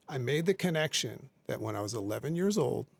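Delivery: normal talking voice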